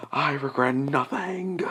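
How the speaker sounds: a lightly voiced smug ghost; drawn-out impish declaration; cheeky ghost voice. Ghost's voice while flying away.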